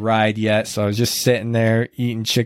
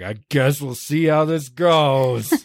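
groaned out, higher and slow; tired groan; 4 semitones higher, slower than usual. Retold or imitated. Exhausted runner groaning to his pacers, slow groan. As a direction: groan it like you can barely go on. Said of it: "it was more like a groan".

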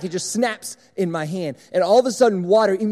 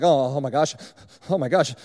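mock hyperventilating; 5 semitones lower than usual. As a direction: lower gasps between panicked words.

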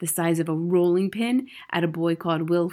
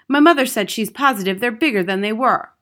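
put-on eager boy voice; 4 semitones higher, 8 dB louder, faster than usual.

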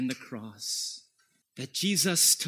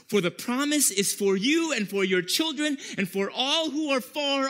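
high, loud scripture reading; full-force preaching; 9 semitones higher, 6 dB louder than usual. Acted. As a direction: proclaim the passage from the pulpit.

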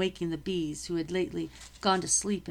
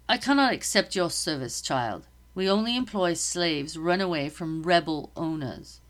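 firm, louder officer refusing her; stern captain; 5 dB louder than usual. Acted. Captain turning the slave girl away, stern and louder. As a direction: shut her down with clipped authority.

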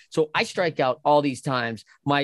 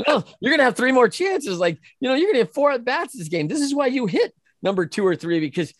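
incredulous rebuke; 7 semitones higher than usual. Retold or imitated. Incredulous coach rebukes quitting hitter.

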